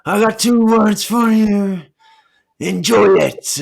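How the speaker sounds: slow, loud wrestler promo voice; bad acting.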